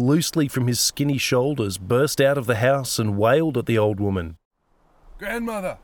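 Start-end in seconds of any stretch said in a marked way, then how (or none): 5.2-5.8 high, soft strangled shriek. A narrator's reading. Young man bursts out to the old woman, shrieking. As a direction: wail it high without full volume. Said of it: "he shrieked".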